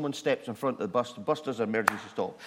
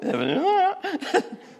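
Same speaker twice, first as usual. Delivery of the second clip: high laughter; 7 dB louder than usual. Real, not acted. Laughing at own story.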